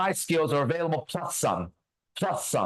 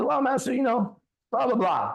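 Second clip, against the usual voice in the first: high, mocking imitation of waffling; imitated hedging; 10 semitones higher than usual. Teacher stalling his student's request, imitated mock hedging. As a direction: put him on in a raised, dithering voice. Mimics the teacher's reluctant answer.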